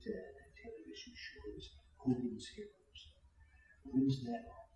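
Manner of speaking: soft, breathy Reagan voice; Reagan impression. DJ as Reagan asking about Hogan's Heroes, soft and breathy. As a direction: hush it like an aging president. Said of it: "Ronald Reagan".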